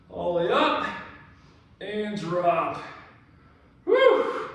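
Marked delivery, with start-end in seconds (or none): 3.9-4.5 high, loud whoop; woo yell. Real, not acted. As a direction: let out a big whoop. Yell during reps.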